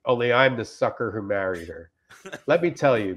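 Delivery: ordinary talk, nothing marked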